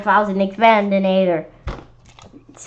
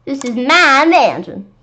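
gloating; 6 semitones higher, 8 dB louder, slower than usual. Acted. Monster gloating.

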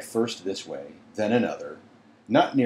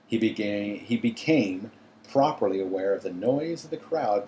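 plain reading voice

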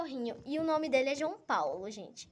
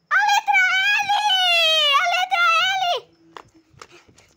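very high, loud triumphant yell; excited shout; 13 dB louder, slower than usual. Real, not acted. Finds last letter, yells.

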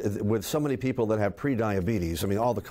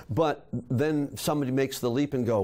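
ordinary talk

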